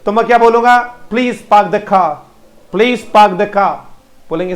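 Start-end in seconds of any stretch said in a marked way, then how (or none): 1.1-3.8 पार्क as "paak" and car as "khaa", both R's silent; phonetic English pronunciation. Shown as a demonstration. Teacher shows pupils the phonetic sentence, silent R's. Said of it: "English में पूरा phonetically बोलूं".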